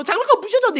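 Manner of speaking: high, hammed-up wail; an adult pretending to bawl along with a child; mock crying. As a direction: cry it out in a very high voice. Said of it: "같이 울어".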